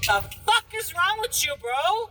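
retelling how she yelled at the guy who slapped her, outraged. Retold or imitated. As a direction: shriek it at him in disbelief.

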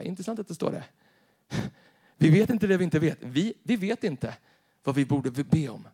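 ordinary delivery

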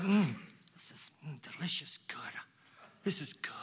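savoring God. Retold or imitated. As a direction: savor it like a delicious bite. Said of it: "God is like".

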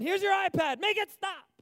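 high, loud fed-up plea; mock desperate outburst; exasperated yell. Annoyed by beeping iPad.

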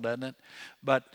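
ordinary talk, nothing marked

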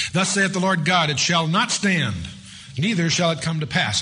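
scripture reading. Acted. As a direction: read it out like solemn scripture.

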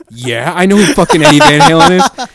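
laughing retort. Retold or imitated.

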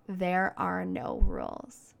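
normal talking voice

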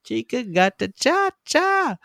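very high, slow half-sung recital; sing-song lyric voice. Retold or imitated. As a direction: lilt it high like a goofy singer.

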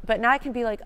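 normal talking voice

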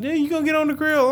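high teasing voice; cousin's voice. Retold or imitated. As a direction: pitch way up to tease. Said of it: "my cousin always be like".

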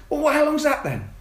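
put-on nagging little kid who won't let it go; high kid voice, mock exasperated; pestering kids.

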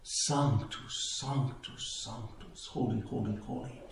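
soft words fading to a whisper; hushed adoration. Retold or imitated. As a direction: murmur it in awe, dying into a whisper. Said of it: "after having gazed upon the host".

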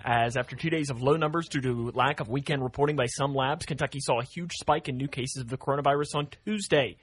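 steady radio news delivery; news read. Acted. Morning host reading covid numbers to listeners, news-read style.

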